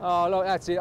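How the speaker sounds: usual speaking voice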